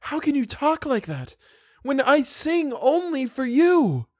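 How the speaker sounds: sad and trembling. Acted. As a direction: Christine answers the hidden voice, near tears. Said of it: "infinitely sad and trembling".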